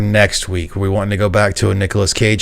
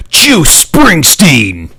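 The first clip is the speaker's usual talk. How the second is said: drawn-out, much higher, louder announcer shout; hyped ad yell; 8 semitones higher, 7 dB louder, much slower than usual. Retold or imitated. Quoting the Power Thirst ad, slow booming ad-hype shout. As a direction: stretch the name out slowly like a hyped ad. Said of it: "I just love the power thirst ones".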